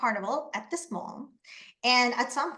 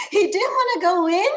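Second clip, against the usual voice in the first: laughing; 7 semitones higher, 7 dB louder than usual. Real, not acted. Laughing at the boy's turnaround.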